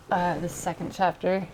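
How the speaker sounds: ordinary talk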